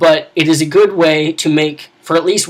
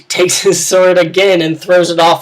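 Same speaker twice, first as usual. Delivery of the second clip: ordinary delivery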